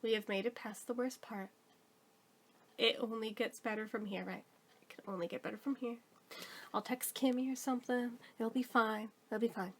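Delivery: hushed self-reassurance; quiet self-talk. Retold or imitated. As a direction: murmur it to yourself, trying to stay hopeful. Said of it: "I'm thinking".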